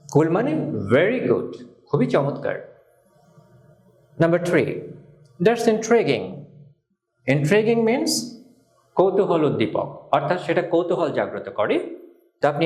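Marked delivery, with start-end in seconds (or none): none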